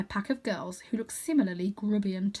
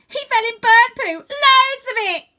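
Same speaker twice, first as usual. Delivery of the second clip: very high, much louder gleeful squeal; little girl jeering; 13 dB louder, slower than usual. Acted. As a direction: shriek with mocking delight, slow at end.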